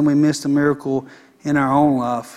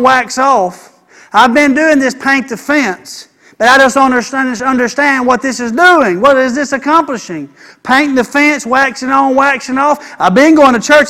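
high, loud pulpit preaching; fiery sermon climax; preaching at full force; 7 semitones higher, 7 dB louder than usual. Acted. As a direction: drive every line home to the congregation. Preaching again, voicing Daniel.

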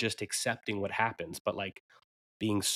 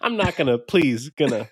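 laughing; 7 semitones higher, 4 dB louder than usual. Real, not acted. Joking wordplay while laughing.

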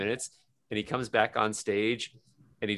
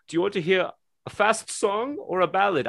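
mock German singer asking the crowd, higher; hammed-up German accent, louder; 11 semitones higher, 6 dB louder than usual. German singer asking crowd fast song or ballad, in a German accent. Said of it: "a German accent".

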